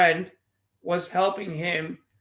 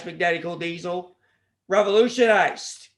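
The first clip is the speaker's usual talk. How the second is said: usual speaking voice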